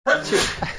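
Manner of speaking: a second, high sneeze. Real, not acted. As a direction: let out a sneeze. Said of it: "I gotta sneeze".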